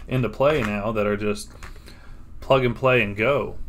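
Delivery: usual speaking voice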